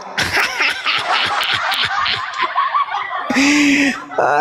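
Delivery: long, high cackling laugh; high laughter running on and on; high-pitched laugh. Real, not acted. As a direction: burst into a long squealing laugh. Jeering at someone who fled crying.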